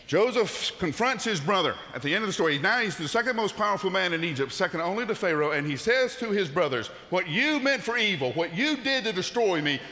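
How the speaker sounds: high, loud, fervent preaching; preaching at full force. Acted. Climax of the sermon.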